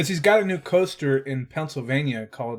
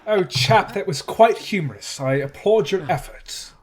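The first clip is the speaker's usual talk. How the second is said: higher, badly faked British accent; worst British accent; 5 semitones higher than usual. Co-host applauding the guest's efforts, worst British accent. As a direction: put on a mock British gent accent. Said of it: "talk in our worst British accents".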